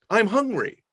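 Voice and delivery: put-on a kid announcing a problem; hint of a complaining child; mock child complaint. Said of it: "children come into the kitchen and they say".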